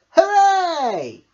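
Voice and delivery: high, loud triumphant cheer; happy cheer. A narrator's reading. Smiley Shark cured at last, loud cheer. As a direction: cheer with all your heart. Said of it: "he cheered".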